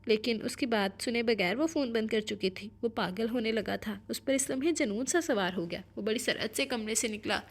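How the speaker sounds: usual speaking voice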